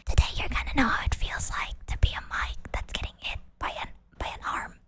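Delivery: soft unvoiced whisper, close and intimate; ASMR whisper. Shown as a demonstration. Still in her ASMR act, a whispered close to the stream. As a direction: murmur it like a tingly ASMR video. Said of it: "one of my A S M R voices".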